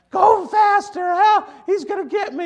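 panicked high-pitched scream; real high voice. Retold or imitated. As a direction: shriek it like a tough kid with a tiny high voice. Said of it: "He had a real high voice".

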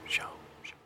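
whispered echo of show; echo effect. Pretend. Host mimicking an echo for the audience, breathy echo. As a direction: fake a fading echo on show. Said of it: "Why am I doing that".

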